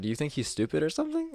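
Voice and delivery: high, quick challenging question; mock an offended customer's challenge; imitated indignant customer. Rude customer retold challenging him.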